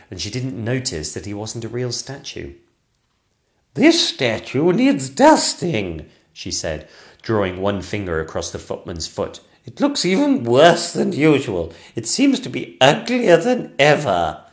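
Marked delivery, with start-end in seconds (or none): 3.7-6.1 high, loud, very drawn-out regal voice; a queen passing judgement slowly; disapproving Queen. Acted. 9.7-14.4 high, loud regal complaint; a queen loudly finding fault; disdainful Queen. Acted.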